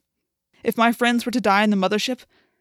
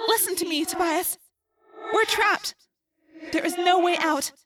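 put-on high, distant girl, pleading gravely; trapped Rachel; 10 semitones higher than usual. A narrator's reading. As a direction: plead in a high voice, resigned to the worst. Plays trapped Rachel in narration.

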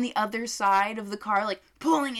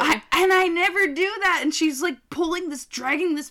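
excited; 8 semitones higher, 8 dB louder than usual. Real, not acted. Worked-up telling.

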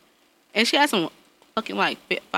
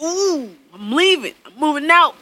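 son's dramatic exit; 5 semitones higher, 3 dB louder than usual. Retold or imitated. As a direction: drag it out like a sulky child.

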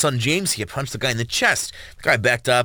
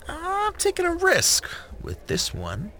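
much higher, slow and deliberate; slow, high lawyer voice; slower than usual. Acted. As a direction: draw it out high and cool.

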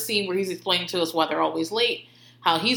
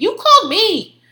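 indignant outburst; 11 semitones higher, 9 dB louder than usual. Real, not acted. Indignant retort to mom.

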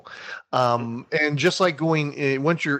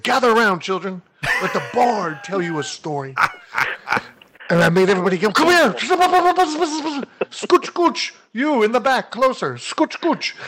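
storyteller bard; 7 semitones higher than usual. Retold or imitated. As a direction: summon the crowd like a theatrical bard. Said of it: "I said".

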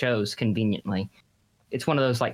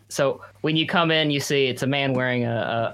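ordinary talk, nothing marked

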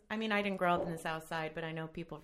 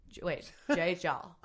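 ordinary talk, nothing marked

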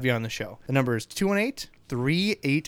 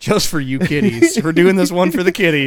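mock announcer; 4 semitones higher, 9 dB louder than usual.

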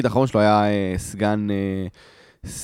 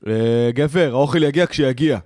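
mock gruff brush-off, punched out word by word; dismissive army quartermaster; 2 semitones higher, 4 dB louder, slower than usual. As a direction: imitate a curt, couldn't-care-less message. Retold dismissive message from quartermaster.

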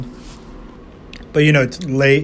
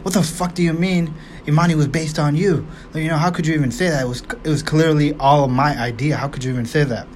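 mock outrage; 2 semitones higher than usual. Boyfriend snapping at his girlfriend, mock outraged. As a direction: fire it off like a defensive boyfriend. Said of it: "and then he's like".

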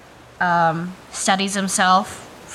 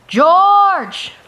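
very loud, high drawn-out call; husky full-force shout; 12 semitones higher, 11 dB louder than usual. Acted. Player's character calls out for a missing friend, husky shout. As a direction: bellow the name as loud as you can. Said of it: "I shout in my husky voice in, in the loudest possible decibel".

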